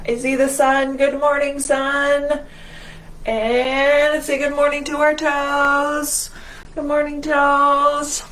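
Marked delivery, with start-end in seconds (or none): none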